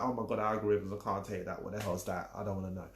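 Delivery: a touch of mock panic; softly whining about algorithms; mock overwhelmed. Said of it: "You're like".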